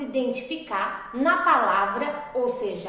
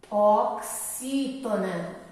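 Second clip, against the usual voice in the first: "Oxítona" very slow and lower; slow dictation; 4 semitones lower, much slower than usual. Shown as a demonstration. Teacher repeating the term for pupils, slow drawn-out dictation. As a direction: spell out "Oxítona" in long, low syllables.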